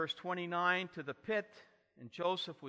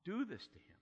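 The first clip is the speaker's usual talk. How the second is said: normal talking voice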